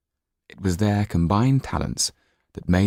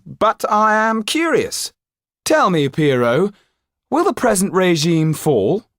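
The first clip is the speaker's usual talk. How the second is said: higher, louder, sly provocation; Louis de Jonquière; 13 semitones higher, 6 dB louder than usual. Acted. Louis de Jonquière provoking the Pierrot, higher and louder. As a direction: needle the room with a loaded question.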